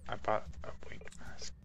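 whispered aside. Real, not acted. Player distracted by the auction house shop, whispered aside. Aside while shopping in game.

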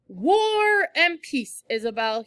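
high, loud slow teasing call; dad calling back teasing, slow and loud. A narrator's reading. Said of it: "he called out".

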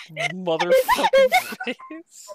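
slow, high words broken by laughter; cracking up over a game character's face; laughing. Real, not acted. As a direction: drag it out through laughter.

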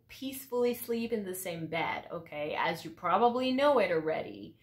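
ordinary talk, nothing marked

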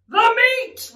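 high, loud crew roar. Acted. Hungry crew echoes captain's demand, roaring. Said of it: "we all roared".

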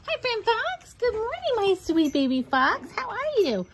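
high cooing pet voice. Real, not acted. Greeting dog in baby talk.

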